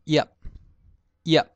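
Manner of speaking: 叶 as Hokkien "ia̍p"; lips closed at end. Shown as a demonstration. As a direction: end 叶 on a shut-lipped p, "iap". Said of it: "最后嘴巴紧".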